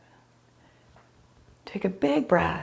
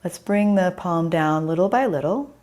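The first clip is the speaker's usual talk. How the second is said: ordinary delivery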